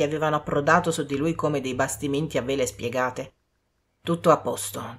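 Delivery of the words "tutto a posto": Rochester calming. A narrator's reading. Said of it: "esclamò".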